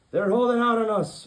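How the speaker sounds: loud, high pirate roar. Acted. Pirate captain roaring to his crew, very loud. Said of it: "roared the pirate captain".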